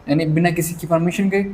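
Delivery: ordinary talk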